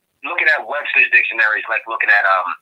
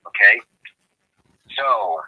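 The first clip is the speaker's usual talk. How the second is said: ordinary talk, nothing marked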